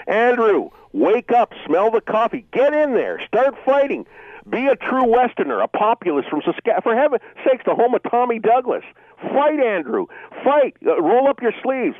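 high, pushing exhortation; fired-up call to action; impassioned rallying cry. Real, not acted. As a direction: rouse him to fight. Caller urging Scheer to fight.

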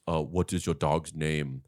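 lightly voiced low, soft, slow machine; Terminator. Terminator quizzing on the phone, lightly imitated. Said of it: "He's doing his voice".

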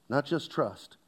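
usual speaking voice

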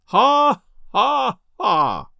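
un ha sonore et plus haut, puis ça retombe; bellowed laugh. A narrator's reading. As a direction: triomphe railleur face à la foule. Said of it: "he threw back his shoulders and bellowed".

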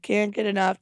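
ordinary talk

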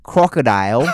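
loud, hammed-up Aussie; Australian accent attempt. Shown as a demonstration. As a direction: punch it out in mock Australian. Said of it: "an equation for Australian accent".

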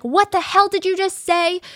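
high, slow indignant shriek; mock furious scream. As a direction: yell back, livid. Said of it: "She would scream back at them".

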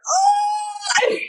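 very high, crying "Oh"; imitating a weeping teen; sobbing daughter's wail. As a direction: let out a teary wail. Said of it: "she's like".

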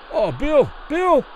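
high-pitched urgent plea; pleading. A narrator's reading. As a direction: call out the name, begging. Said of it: "Kelly pleaded to the referee".

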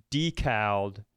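ordinary delivery

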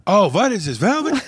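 put-on high-pitched Yiddish-accented quote.

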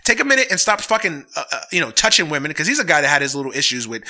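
normal talking voice

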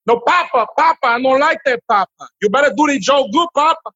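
high-pitched mock Dominican accent, pleading Papa; lightly exaggerated Dominican Spanish-flavoured English. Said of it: "for Dominicans".

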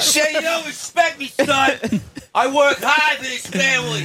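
very high-pitched aggrieved father holler; crazy Boston dad. Acted. Dad demanding respect from his son, hammed-up Boston yelling. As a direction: play a ranting Boston dad. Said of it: "My crazy dad in Boston".

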